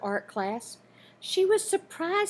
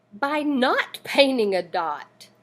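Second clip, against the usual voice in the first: plain reading voice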